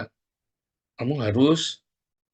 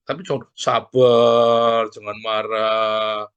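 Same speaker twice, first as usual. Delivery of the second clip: drawn-out, louder mock calming; slow mock soothing; 4 dB louder, much slower than usual. The meddler lecturing an angry friend, slow mock soothing. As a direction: draw out the words like a preachy calmer. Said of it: "itu di situ kamu ngomong".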